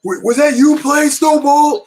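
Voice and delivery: high, loud shouted accusation; yelling cop. Retold or imitated. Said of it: "he was yelling".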